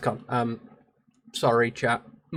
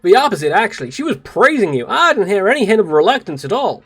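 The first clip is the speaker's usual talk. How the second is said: louder, insistent heroine voice; earnest game character; 5 semitones higher, 5 dB louder than usual. Acted. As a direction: correct her with eager conviction.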